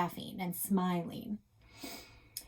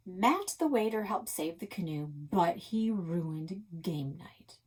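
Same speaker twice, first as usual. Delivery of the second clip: ordinary delivery, nothing marked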